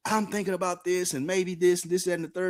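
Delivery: high playful retelling voice. Retold or imitated. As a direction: voice himself light and high. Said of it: "So I told her".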